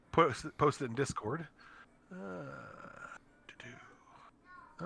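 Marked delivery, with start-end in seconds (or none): 2.1-4.8 whispered under the breath. Real, not acted. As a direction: whisper to yourself while busy. Waiting while posting image.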